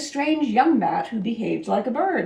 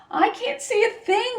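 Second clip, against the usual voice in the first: higher, alarmed yell; 11 semitones higher than usual. A narrator's reading. Pip lost in the night sky, yelling in alarm. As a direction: cry out lost in the dark. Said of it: "yelled Pip".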